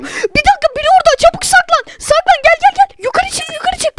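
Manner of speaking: very high, panicky urging; urgent alarm. Real, not acted. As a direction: hurry a friend into hiding, pitch way up. Spots someone, orders quick hiding.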